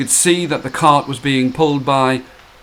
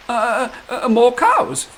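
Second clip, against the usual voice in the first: hesitant higher hint; 8 semitones higher, slower than usual. Acted. Princess hesitating.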